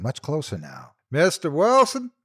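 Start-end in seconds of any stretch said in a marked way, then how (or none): 1.1-2.1 high, loud calls; searchers calling. A narrator's reading. Search party calling for the missing men, loud. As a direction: call the names across the valley. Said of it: "The voices called again".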